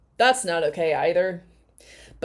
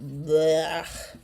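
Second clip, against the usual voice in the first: mocking; 2 semitones higher, much slower than usual. Mocking the couple's clip.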